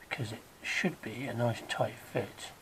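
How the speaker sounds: usual speaking voice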